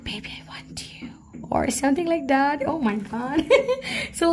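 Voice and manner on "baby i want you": breathy whisper right in your ear. Retold or imitated. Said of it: "try to whisper you into your ears".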